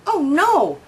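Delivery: a hint of an annoyed wolf huffing; wolf huffs. A narrator's reading. Wolf sees the boiling pot, a mild huff. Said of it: "huffs the wolf".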